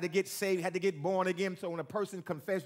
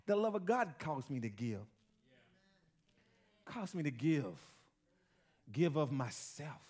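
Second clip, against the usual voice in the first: soft reflective preaching; 5 semitones lower, 7 dB quieter than usual. Acted. Pastor quietly reflecting on giving, soft and low. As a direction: pull back and let it sink in.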